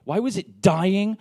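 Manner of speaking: a touch of mock despair, asking the grass why; half-mocking exasperation at the dying lawn; exasperated self-talk. Retold frustration over dying grass.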